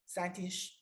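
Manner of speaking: ordinary talk, nothing marked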